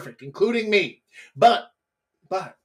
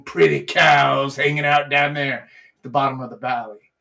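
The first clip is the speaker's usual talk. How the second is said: normal talking voice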